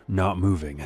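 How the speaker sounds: ordinary delivery, nothing marked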